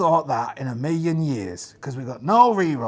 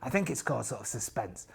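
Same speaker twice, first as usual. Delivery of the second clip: usual speaking voice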